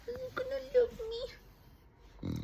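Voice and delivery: very high, slow cooing pet voice; slow high cooing at the dog; drawn-out baby talk. Acted. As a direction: stretch it out sweetly to the dog. Talking to her dog.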